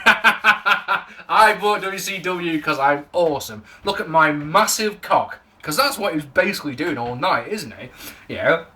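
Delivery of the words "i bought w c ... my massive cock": slow, boastful, laughing Vince impression; mock Vince McMahon. Vince McMahon bragging about buying the company, slow and smug. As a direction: gloat slowly like a smug boss. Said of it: "Vince McMahon going".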